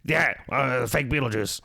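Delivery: a touch of a zany Beetlejuice voice; lightly hammed Beetlejuice impression; fake Beetlejuice. Shown as a demonstration. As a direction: play the knockoff Beetlejuice. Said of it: "We'll call him Beetlejuice".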